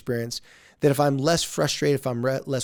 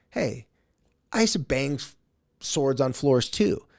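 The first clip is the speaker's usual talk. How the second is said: ordinary talk, nothing marked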